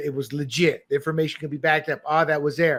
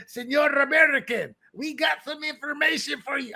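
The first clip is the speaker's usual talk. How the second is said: hammed-up Dutch accent, pitched high; attempted Dutch accent; 8 semitones higher than usual. Dutch informants tipping off the Americans, in a mock Dutch accent. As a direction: put on a bad Dutch accent that sounds Spanish. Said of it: "That's my Dutch accent".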